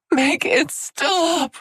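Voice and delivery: slow, high sobbing gasps. A narrator's reading. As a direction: cry it through gasping sobs. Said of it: "she cried around the gasping sobs".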